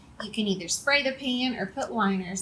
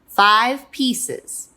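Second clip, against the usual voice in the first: firm, louder falling answer; chiming parents; 6 dB louder than usual. Acted. Parents chiming back to whining child, firm and loud. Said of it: "they chimed".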